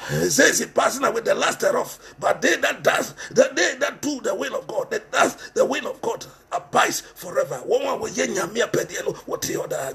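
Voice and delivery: ordinary talk, nothing marked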